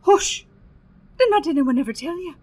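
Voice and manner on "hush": a loud, urgent hush; sharp hush. Acted. Landlady silencing her guest with a loud hush. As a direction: cut her off before she names them. Landlady hushes talk of fairies.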